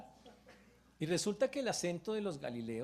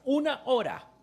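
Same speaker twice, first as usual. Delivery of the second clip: much louder, higher climax; preaching shout; 9 semitones higher, 11 dB louder than usual. Real, not acted. Preacher stirring the congregation, loud rising shout. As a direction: build up and shout it out.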